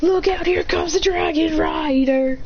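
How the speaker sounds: slow, high announcing cry; heroic call-out. Retold or imitated. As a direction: call out slowly and high, hero-style. Figure calls out a warning.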